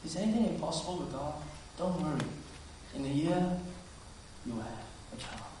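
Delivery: soft, hushed reassurance; gentle. Acted. God comforting Sarah with a promise, gentle. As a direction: reassure tenderly, fading almost to a whisper. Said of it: "comes back to her gently and say".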